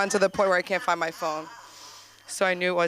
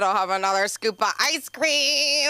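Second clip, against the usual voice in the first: raised, louder mock-dramatic exclamation; hammed-up joking plea; 3 semitones higher, 6 dB louder than usual. Comic acting out her gun-to-my-head joke, hammed-up. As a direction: ham it up over a silly treat.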